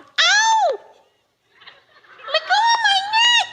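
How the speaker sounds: shrill high screaming woman; hammed-up high pitched lady's scream. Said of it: "She's going, she's going".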